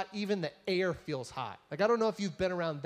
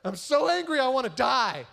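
loud, high-pitched mock outburst; hammed-up tantrum, pitch climbing; angry; 5 semitones higher, 6 dB louder than usual. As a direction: throw an exaggerated angry fit. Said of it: "he's sitting there angry and upset".

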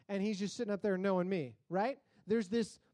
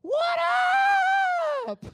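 very high, very loud whoop; loopy greeting yelled to a packed waiting room; drugged shout; 12 dB louder than usual. Retold or imitated. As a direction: holler it across the lobby. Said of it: "The first thing I do is go".

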